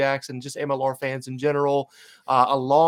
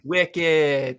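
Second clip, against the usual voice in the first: wicked said the Boston way, coaching. Shown as a demonstration. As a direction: model the word wicked in a Boston accent. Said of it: "So just take notes".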